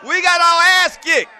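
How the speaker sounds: high, loud holler with laughter behind it; hollered outburst. Real, not acted. Radio host reliving a high school defeat, hollering.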